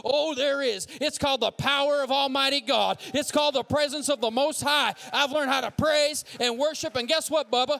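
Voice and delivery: loud, high, driving sermon climax; full-force preaching. Real, not acted. Preacher to congregation on God's power, full-force preaching.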